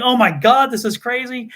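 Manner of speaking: retold shocked principal. Principal hearing mom threaten the teacher, shocked. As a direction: imitate an appalled principal.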